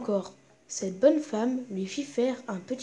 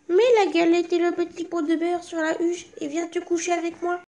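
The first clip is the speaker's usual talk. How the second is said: wolf keeping up a higher granny voice; wolf posing as grandmother; 7 semitones higher than usual. Acted. Wolf hidden under the blanket coaxes the girl into bed, higher voice. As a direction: coax her in a higher put-on granny voice.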